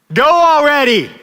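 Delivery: high, drawn-out impatient holler; full-voiced mock yell from the back seat; imitated child's yell. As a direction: yell it like an impatient little kid.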